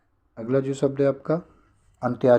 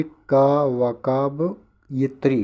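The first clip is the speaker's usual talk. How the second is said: slow broken-up word, last part drawn out; syllable by syllable; much slower than usual. Shown as a demonstration. Teacher makes students memorise the word, slowly in syllables. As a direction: spell it out in chunks to memorise. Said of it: "ये आप रट लीजिए".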